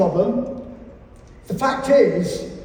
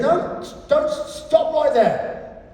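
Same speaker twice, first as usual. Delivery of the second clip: high, urgent cutting in; mock alarm; 7 semitones higher than usual. To the guitarist, mock-urgent high interruption. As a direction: stop him short, slowly. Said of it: "I said".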